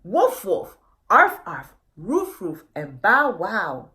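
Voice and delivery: calling out barks so all can hear; loud barking. Acted. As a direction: bark it out across the town. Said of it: "you could hear everywhere".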